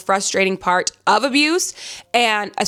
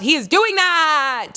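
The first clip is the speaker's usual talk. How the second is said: higher and slow, stressing each word with delight; excited emphasis; 6 semitones higher, slower than usual. Real, not acted. Swooning reader gushing to viewers about the hero, excited. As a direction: savor every word like you're swooning.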